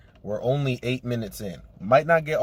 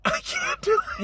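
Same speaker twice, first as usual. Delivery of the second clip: breaking character. Real, not acted. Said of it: "I'm laughing too much".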